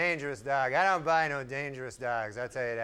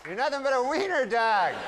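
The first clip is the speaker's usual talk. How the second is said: high, startled voice yelling at the dog; yelling at wiener dog; 10 semitones higher than usual. Acted. Man woken at night yelling at his wiener dog, high and startled. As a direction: shoo the dog away in a high mock panic. Waking to dog nibbling throat.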